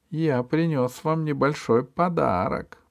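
purring. A narrator's reading. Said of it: "промурлыкал он".

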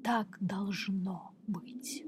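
fading whispered echo. A narrator's reading. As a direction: let it die away like an echo. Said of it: "послушное эхо повторило его слова".

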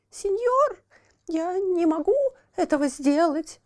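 put-on high lamenting child voice; pitiful puppet boy. A narrator's reading. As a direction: plead in a high thin voice. Buratino voiced in story.

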